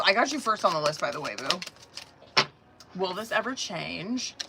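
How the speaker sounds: ordinary talk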